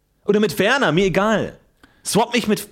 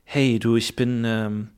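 coy low flirting; low Milbea voice; 6 semitones lower than usual. Acted.

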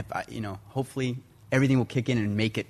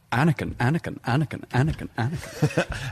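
imitating a British Jedi master, calling a name; light British Jedi voice, repeating the name; Ewan McGregor as Obi-Wan; 3 semitones higher than usual. Shown as a demonstration. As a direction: do the younger Jedi master impression. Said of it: "wanting me to do Ewan McGregor, Obi-Wan Kenobi".

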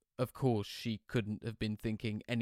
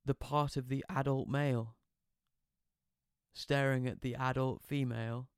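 usual speaking voice

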